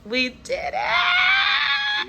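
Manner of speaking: high, very loud, slow cheer; excited shout. Real, not acted. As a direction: shout it out in triumph. Celebrating the new home.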